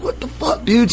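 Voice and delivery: breathy whispered disbelief; stunned whisper. Retold or imitated. Brother stunned by the skins, whispering. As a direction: drop to a whisper, aghast.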